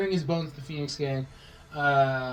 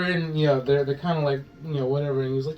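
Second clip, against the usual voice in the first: normal talking voice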